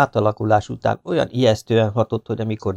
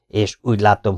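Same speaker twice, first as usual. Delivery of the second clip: plain reading voice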